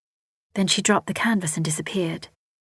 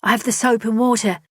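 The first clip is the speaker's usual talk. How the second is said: higher, louder call from outside; 4 semitones higher, 4 dB louder than usual. A narrator's reading. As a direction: call in to them. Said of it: "Rosie called out".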